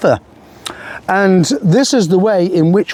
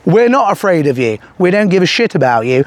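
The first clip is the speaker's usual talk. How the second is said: ordinary talk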